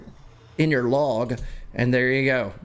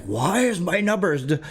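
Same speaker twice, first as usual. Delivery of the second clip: raised pitch, mildly panicked self-quote; retold freak-out; 6 semitones higher than usual. Streamer recalling his dropping numbers to viewers, mock freak-out. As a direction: imitate yourself freaking out, voice lifted. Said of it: "like, freaking out".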